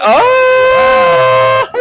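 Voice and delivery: high delighted shriek; surprised shriek. Real, not acted. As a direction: squeal with surprise. Reacts to bomb pun.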